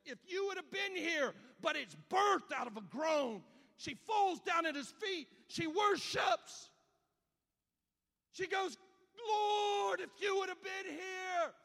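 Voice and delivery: loud, high, impassioned preaching shout; full-force preaching. Acted. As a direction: climb to a roaring pulpit peak. Preaching Mary's cry.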